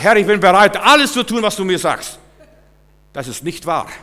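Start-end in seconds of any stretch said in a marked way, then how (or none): 0.0-2.2 loud, high, overeager vow; mock eager prayer. Preacher mocks the hasty prayer, loud and high. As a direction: pray it too eagerly, a hammed-up promise.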